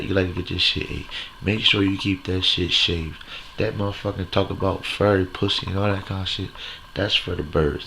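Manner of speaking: ordinary delivery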